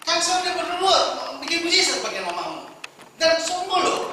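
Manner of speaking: loud indignant protest; indignant claim. Acted. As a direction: insist you earned it. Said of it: "makanya dia klaim".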